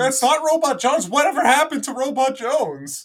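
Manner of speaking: high, loud cry of disbelief; mock outraged shout. Acting out a fan's upset at a changed voice, high outraged shouting. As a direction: shout it in exaggerated indignation.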